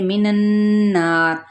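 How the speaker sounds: मिनन्नार slow with the नन held nasal; doubled nun. Shown as a demonstration. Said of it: "नून मुशद्दद है".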